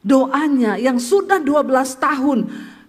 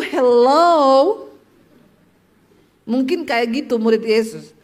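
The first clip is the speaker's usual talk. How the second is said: usual speaking voice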